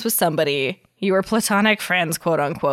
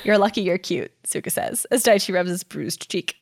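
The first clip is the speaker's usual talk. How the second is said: story narration; 5 semitones higher than usual. Acted. Reads fanfic aloud.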